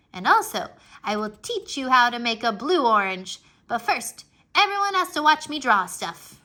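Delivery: put-on loud, bossy little-girl teacher voice; pretend teacher. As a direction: play the teacher, commanding the room. Junie plays teacher to empty class.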